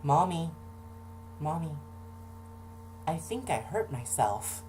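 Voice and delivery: lightly voiced small kid calling for his mother; little kid calling mom. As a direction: call for mommy like a small hurt kid. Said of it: "And so I'm just like".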